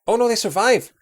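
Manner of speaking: sudden high, loud yelp of surprise; real surprise. Real, not acted. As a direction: blurt it out, caught off guard. Lemmings unexpectedly survive a fall.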